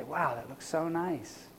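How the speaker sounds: quiet admiring wow. Retold or imitated. Homeowner looking over the finished yard, softly pleased. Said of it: "We look and we say".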